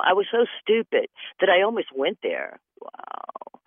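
ordinary talk, nothing marked

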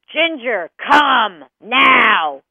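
very loud, forceful command; booming order, each word punched; yelling at dog. Acted. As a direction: imitate a big man bellowing at his dog. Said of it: "he yells at his dog".

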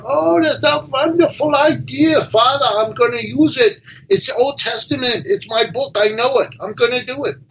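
a thrilled rabbi seizing the idea; eager rabbi. Acted.

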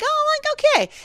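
high-pitched mock "oh" of realization; retold surprise. Recalling the first glimpse of nudity, mock surprised. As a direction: act out a pleasantly startled reaction.

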